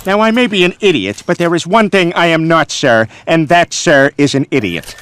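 loud pompous declaration; loud indignant. Real, not acted. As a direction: proclaim it loudly, pompous.

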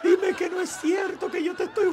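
high, laughing fake wail; laughing mock sob in the commenter's voice; mock crying. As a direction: ham up a tearful plea.

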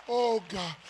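ordinary talk, nothing marked

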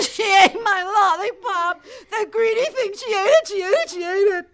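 high, loud sibling wail; hammed-up indignant complaint, repeated insistently; outraged accusation. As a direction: protest like a wronged child. Sister's angry complaint to mother.

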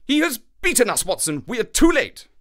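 high, loud cry of despair; distraught outcry. A narrator's reading. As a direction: cry out as if all is lost. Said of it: "like a man distracted".

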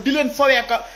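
ordinary talk

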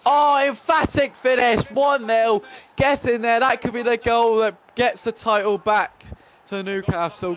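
soak in the finish; excited goal call. Real, not acted. Gamer celebrating the title-winning goal. His team scores the opener.

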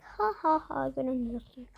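ordinary talk

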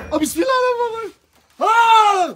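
high shriek into a prayer; frightened scream. Real, not acted. Vlogger terrified by the shout, frightened scream.

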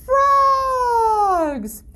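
put-on high, bright answer for the kids; a high delighted reveal of the answer; excited reveal. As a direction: sing out the answer like a prize. Quiz reveal to children.

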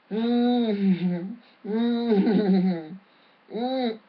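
strained "mm" of someone unable to speak; imitated tongue-tied humming. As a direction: hum as if the words won't come out. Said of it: "He could not say it".

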